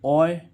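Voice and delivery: ओय said as a loud, drawn-out "oy"; sound demo. Shown as a demonstration. Teacher drilling the class on the sound ओय. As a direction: stretch out ओय clearly and loudly. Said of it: "इस ओआरई के ओ का उच्चारण".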